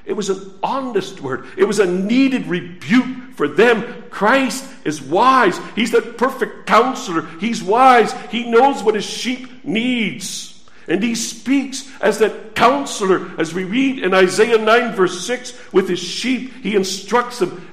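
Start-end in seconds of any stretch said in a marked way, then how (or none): none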